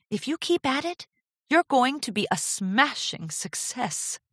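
high-pitched, touched, encouraging designer; touched Rarity. A narrator's reading. Mentor praising apprentice's gown and future, touched. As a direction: praise her in a lifted, emotional voice.

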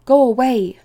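sudden loud, high plea; instant urgent cry. A narrator's reading. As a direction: burst out at once, urging escape. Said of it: "said Fiver instantly".

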